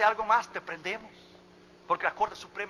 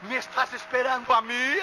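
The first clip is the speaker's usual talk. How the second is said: high, loud disbelief; acted amazement; astonished question; 5 semitones higher, 6 dB louder than usual. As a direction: ask it as if you cannot believe it. Astonished pastor replies to guard.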